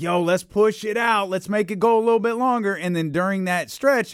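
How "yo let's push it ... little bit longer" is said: high, eager promoter pitch; upbeat boss voice. Retold or imitated. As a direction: pitch it like a hyped booker. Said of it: "they were like".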